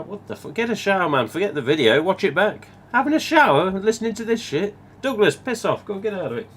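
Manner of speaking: high-pitched mock indignation, hammed-up; mock outrage. Vlogger scolds a viewer who listens in the shower, with hammed-up mock outrage. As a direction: play at being scandalised, laughing underneath.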